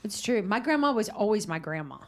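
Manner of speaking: ordinary talk, nothing marked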